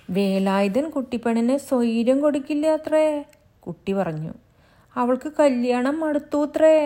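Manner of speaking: put-on high, loud child's voice; little child. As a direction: tell it like an eager little child. Child reports gossip.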